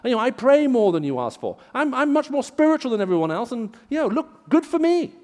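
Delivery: high, loud self-congratulating prayer; hammed-up spiritual show-off, pitch climbing to the end; mock boastful. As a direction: imitate a man smugly boasting to God. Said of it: "He boasts about his spirituality".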